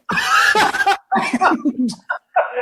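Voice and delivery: a long high laugh at his own story; burst of laughter. Real, not acted. Laughing after punchline.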